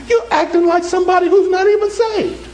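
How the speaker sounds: high-pitched, emphatic preaching cry; preacher's shout. Real, not acted. Preacher hammers repeated point home.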